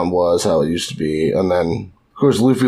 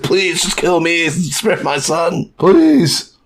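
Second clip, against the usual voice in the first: mock pleading; 8 semitones higher, much slower than usual. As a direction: beg for your son's life, hammed-up. Toto begging the king.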